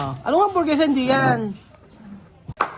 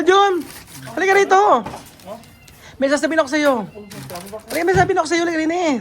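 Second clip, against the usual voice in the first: high, loud summons; calling someone over; 7 semitones higher, 4 dB louder, faster than usual. Real, not acted. Calling a companion over to say something, raised voice. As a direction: call across to fetch them.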